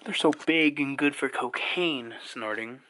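ordinary talk

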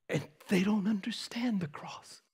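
hushed, awed whisper. Real, not acted. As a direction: drop to a breathless whisper. Sudden drop after loud preaching.

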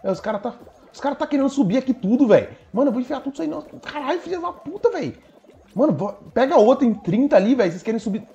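very high, loud panicked yells; frantic yelling. Real, not acted. Frantic climb, players pulling him. (gritando desesperado, bem agudo)

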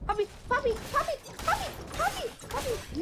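high, sing-song calls of the name; high calling out. Real, not acted. Gamer calling a friend by name, high and insistent. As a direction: call him over and over, pitched high.

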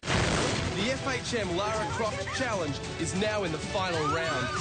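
slow, dramatic TV trailer narration; dramatic voiceover. Acted. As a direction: build suspense like a show announcer. Dramatic voiceover before the final.

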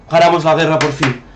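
loud, high cheer, slowing into a relieved sigh; celebrate, then let the relief out; relieved victory cheer. Real, not acted. Streamer telling viewers the war is finally won, loud relieved cheer.

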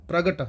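ਪ੍ਰਗਟ with half r joined to p, pra-gat; correct reading. Shown as a demonstration. Teacher models the right ਪ੍ਰਗਟ with half rara. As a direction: join the r to p in ਪ੍ਰਗਟ. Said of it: "ਰਾਰਾ ਅੱਧਾ ਹੈ".

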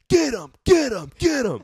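very loud, high repeated shout; mock yell. Imitating the neighbor screaming after them. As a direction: scream it across the yard. Joe yelling from behind.

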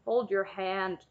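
usual speaking voice

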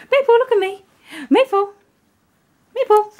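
high sing-song call; calling the puppy. Real, not acted. Owner calling puppy Maple, high coaxing call. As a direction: coax her with a high call.

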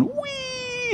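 high squealed whee of delight; a hammed-up gleeful whee; mock whee. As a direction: squeal it like a kid on a ride. Christians on Ferris wheels.

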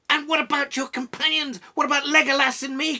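loud, high-pitched outraged demand; bursting with indignation; indignant cry. A narrator's reading. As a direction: cry out, unable to contain yourself. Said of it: "cried Gimli, unable to contain himself".

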